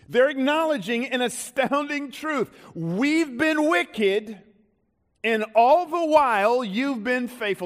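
high, ringing sermon climax; impassioned preaching. Real, not acted. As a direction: preach it with astonishment, drawing out key phrases. Preaches to congregation.

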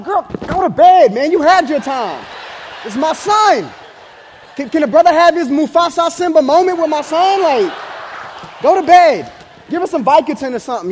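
loud, high, comic outrage; indignant protest. Retold or imitated. Husband to wife over holding their son, indignant high yell.

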